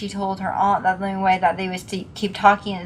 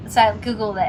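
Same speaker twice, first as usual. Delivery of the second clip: ordinary talk, nothing marked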